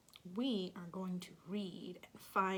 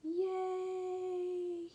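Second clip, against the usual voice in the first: high drawn-out cheer; 9 semitones higher than usual. Acted. Reader cheering the sprout on.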